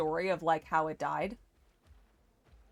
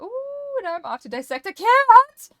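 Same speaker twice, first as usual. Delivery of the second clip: excited; 12 dB louder than usual. Pretend. Game joke, high excited voice.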